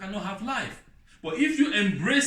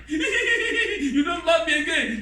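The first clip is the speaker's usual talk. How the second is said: mock crying; 9 semitones higher, 4 dB louder, slower than usual. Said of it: "they start crying".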